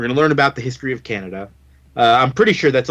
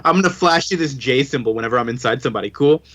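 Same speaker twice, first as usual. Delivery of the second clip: ordinary talk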